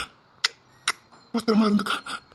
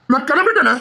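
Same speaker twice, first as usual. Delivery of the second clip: higher, louder second cry of leaving; rising outburst; 6 semitones higher, 4 dB louder than usual. Acted. Storming off, repeating it.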